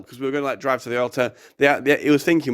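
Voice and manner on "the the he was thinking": ordinary delivery, nothing marked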